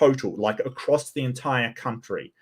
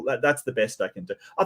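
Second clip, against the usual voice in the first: ordinary talk, nothing marked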